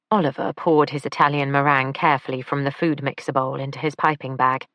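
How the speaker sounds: ordinary delivery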